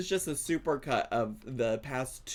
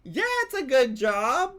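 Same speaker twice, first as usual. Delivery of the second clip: mock bartender; 10 semitones higher, 6 dB louder than usual. Mocking repeat of bartender.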